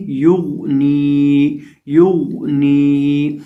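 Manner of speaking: युगनी with a thick, full गोइन, drawn out; heavy गोइन. Shown as a demonstration. Teacher shows the thick गोइन in युगनी. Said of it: "गोइन को मोटा करके पढ़ेंगे".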